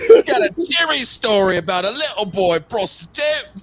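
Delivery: high, loud mock Cockney patter; hammed-up Cockney chimney sweep telling a tale.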